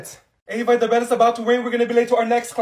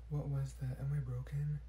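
a hushed whispered question; 7 semitones lower, 16 dB quieter than usual. Acted. Olivia whispering, unsure of her own throat. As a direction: whisper it worriedly to yourself. Whispered aside after noise.

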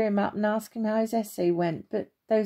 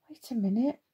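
hushed whisper to himself; whispered, breath only; 3 dB quieter than usual. Acted. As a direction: whisper as if spotting something secret. Andrew spots something up high.

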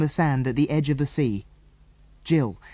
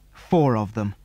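plain reading voice

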